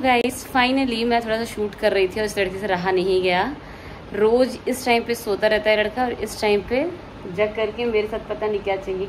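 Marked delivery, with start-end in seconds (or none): none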